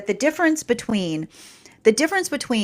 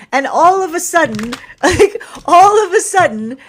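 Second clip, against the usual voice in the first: mock epiphany; 9 semitones higher, 8 dB louder than usual.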